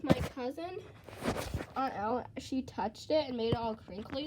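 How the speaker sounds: normal talking voice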